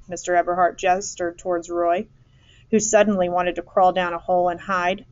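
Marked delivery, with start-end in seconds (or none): none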